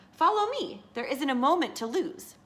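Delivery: high, urgent storybook fox. A narrator's reading. Fox luring the birds toward the palace, high and urgent. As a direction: hurry them along, pitched well up.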